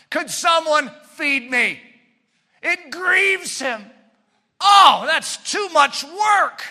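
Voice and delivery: high, loud, slow lazy moan; lazy whine. Acted. Preacher acting the sluggard too lazy to eat. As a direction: drag out the lazy groan.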